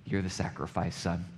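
nearly whispered, a father telling his son the truth; hushed tender answer. Acted. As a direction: break it to him softly.

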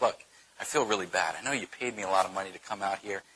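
low, soft, sheepish admission; quietly acted regret; mock apologetic. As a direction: let him down gently, voice dropped. Voices Balaam apologising to Balak.

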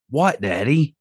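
a hint of a small daughter answering; father imitating his little girl asking what; child's voice. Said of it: "I heard her say".

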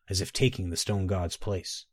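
ordinary delivery

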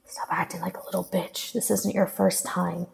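hushed self-scolding, starting in a whisper; whispered inner reproach; chiding himself. A narrator's reading. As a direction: scold yourself under your breath. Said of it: "he chided himself".

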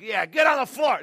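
barked mock police order; loud mock arrest command; joking cop yell. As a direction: play the cop, shouting. Mock cop reply to golfers.

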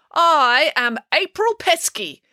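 high, slow, grand self-introduction; bold declaration. A narrator's reading. April pulls off her disguise, bold high declaration. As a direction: declare it slowly and proudly. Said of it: "declared April".

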